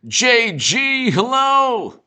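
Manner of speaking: high, loud, drawn-out hello; calling out a greeting. Real, not acted. Host hailing JG on the stream, high loud call.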